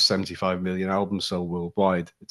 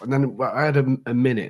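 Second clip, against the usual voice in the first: normal talking voice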